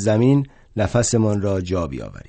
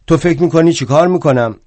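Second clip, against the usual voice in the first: put-on high, fast, loud drunken comeback; fast loud retort; 6 semitones higher, 6 dB louder, faster than usual. Drunk Bakunin retorting to Marx, fast and loud. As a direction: toss it back, high and quick.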